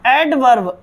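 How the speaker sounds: "एडवर्ब" with an audible R before the last B; students' mispronunciation. Shown as a demonstration. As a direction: say "एडवर्ब" as ed-varb, R sounded. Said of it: "ऐसे करके बोलेंगे इसको". ("एडवर्ब" को एड-वर्ब, र बोलते हुए)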